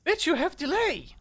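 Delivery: high, loud outburst; loud accusation. Real, not acted. Player calling out a friend's delay, high and loud. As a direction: fire it at a lagging teammate.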